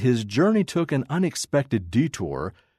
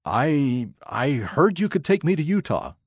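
nervous stammer on the first word; hesitate, then push the request out higher; mildly stammered, higher-pitched plea; 4 semitones higher than usual. A narrator's reading. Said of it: "Henry stammered".

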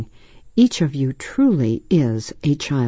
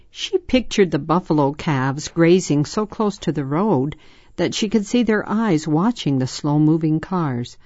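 ordinary delivery, nothing marked

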